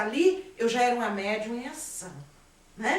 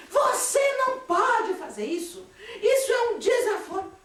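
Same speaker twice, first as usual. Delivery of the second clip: stern scolding; 9 semitones higher than usual. Retold or imitated. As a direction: scold the spirit as an outrage. Husband scolds the spirit.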